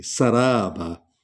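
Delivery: very slow सराबा, seen hissed sharply; whistling seen. Shown as a demonstration. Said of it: "सीन को सीटी की आवाज़ निकलेगी". (धीमा सराबा, सीन की सीटी)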